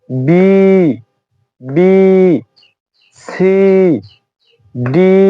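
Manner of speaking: drawn-out, separate English letter names; demonstrated letter pronunciation. Shown as a demonstration. Teacher showing students how to say the alphabet, careful letter-by-letter demonstration. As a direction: model each letter slowly for learners. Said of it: "এভাবে করব".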